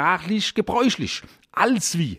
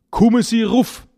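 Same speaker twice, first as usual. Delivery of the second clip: higher, louder call from upstairs; calling down from above; 8 semitones higher, 5 dB louder than usual. Retold or imitated. Calling up a visitor from the top of the stairs, raised call. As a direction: call "Kommen Sie ruf" down the stairs. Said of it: "Von oben rufst du".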